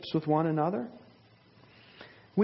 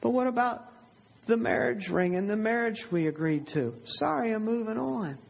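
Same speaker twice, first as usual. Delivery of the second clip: higher, breezy brush-off of a broken promise; dismissive shrug-off; 6 semitones higher than usual. Acted. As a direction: toss it off like it means nothing. Preacher acts out a person backing out.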